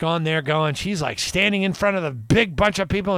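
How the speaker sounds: ordinary talk, nothing marked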